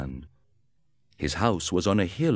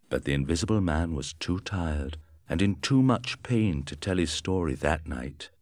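plain reading voice

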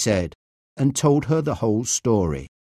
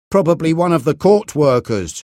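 a touch of anger, louder; angry; 4 semitones higher, 6 dB louder than usual. A narrator's reading. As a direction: say it sharply, annoyed.

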